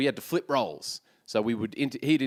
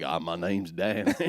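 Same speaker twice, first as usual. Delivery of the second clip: slow, low Texan drawl; put-on Texas guy, slow and deep; 8 semitones lower, slower than usual. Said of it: "so I'll be a Texan".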